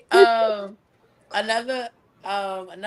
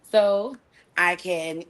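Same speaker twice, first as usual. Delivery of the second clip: normal talking voice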